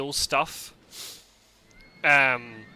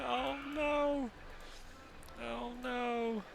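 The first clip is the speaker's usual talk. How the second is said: mock dismay; 11 semitones higher, 8 dB quieter, much slower than usual. Real, not acted. Reacting to failed dating attempt.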